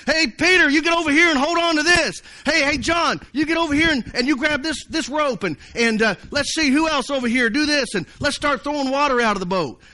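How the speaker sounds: high-pitched urgent hollering; yelling at each other. Acted. Fishermen yelling at each other in the boat, urgent hollering. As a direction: call out orders in a storm, high and hurried. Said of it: "I bet they're yelling at each other".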